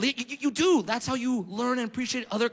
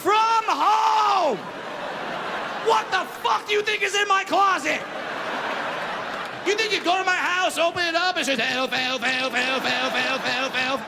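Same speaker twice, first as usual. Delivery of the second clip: much higher and louder, outraged rant; indignant shout; 8 semitones higher, 4 dB louder than usual. Real, not acted. Comic rages at the commercial breakdown, outraged shouting. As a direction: yell it in disbelief at the casting call. Ranting about the elf costume.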